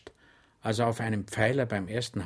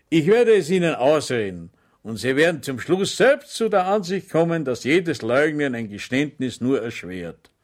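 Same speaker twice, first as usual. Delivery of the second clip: higher, louder over-friendly address; friendly sergeant; 4 semitones higher, 7 dB louder than usual. Acted. Sergeant addressing Schweig sweetly, higher and louder. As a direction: address him with false kindness. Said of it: "ebenso freundlich wie zuvor".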